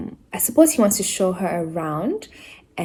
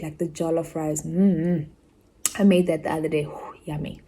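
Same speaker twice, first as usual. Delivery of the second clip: normal talking voice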